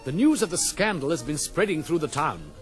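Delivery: ordinary delivery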